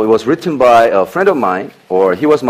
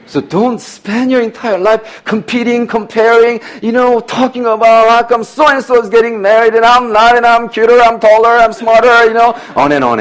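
whiny comparer voice, higher and a bit louder; mock whiny complainer; 12 semitones higher, 5 dB louder than usual. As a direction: mimic someone moaning about others. Mocking comparers, high whiny voice.

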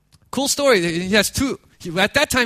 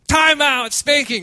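raised, high, drawn-out parental telling-off; retold angry scolding; 7 semitones higher, 4 dB louder, slower than usual. Parents scolding their jealous daughter, angry raised voice. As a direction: act out parents snapping at a hurt child. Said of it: "and the parents say".

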